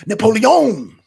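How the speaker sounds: loud French-accented NAPOLEON, swooping up; mock French accent. Shown as a demonstration. Streamer mocking the wrong accent for Gambit, exaggerated French accent. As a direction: blow up NAPOLEON with a long French "-yohn". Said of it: "it was more sounding like".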